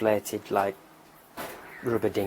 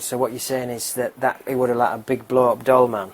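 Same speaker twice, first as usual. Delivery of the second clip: loud recap to a suspect; acted policeman, louder than usual; incredulous police questioning; 3 semitones higher, 9 dB louder than usual. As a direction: check the absurd story, voice raised. Policeman questions robber in joke.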